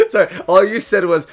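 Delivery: usual speaking voice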